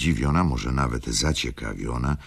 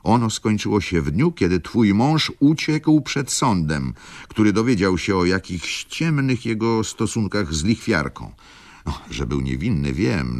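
bitter reproach; 6 semitones higher than usual. A narrator's reading. Mother rails at the daughter.

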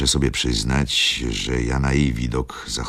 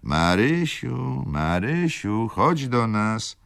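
long drawn-out, high-pitched call; 9 semitones higher, slower than usual. A narrator's reading. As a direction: call slowly to someone floors away. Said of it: "z trzeciego piętra wołał". (przeciągłe, wysokie wołanie)

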